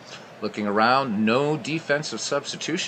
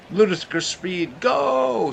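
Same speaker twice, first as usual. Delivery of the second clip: Spaceballs quote; 9 semitones higher, slower than usual. Retold or imitated. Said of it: "definitely a Spaceballs fan there".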